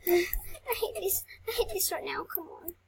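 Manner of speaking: real whispered annoyance; whispered frustration. Real, not acted. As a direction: hiss it quietly to yourself. Frustrated during play.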